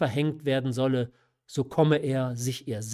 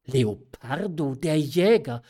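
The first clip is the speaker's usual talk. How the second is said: understated, slow, incredulous echo of the name; a touch of despairing disbelief; dismayed cry; 3 dB louder than usual. A narrator's reading. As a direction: repeat the name in mild dismay. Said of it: "rief die Marquise und drückte ihre Hand mit dem Ausdruck der Verzweiflung".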